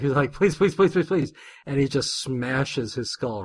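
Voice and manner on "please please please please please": high-pitched, rapid-fire pleading; hammed-up begging for mercy; mock begging. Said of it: "literally begging for his life".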